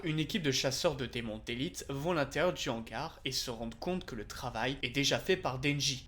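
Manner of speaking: ordinary delivery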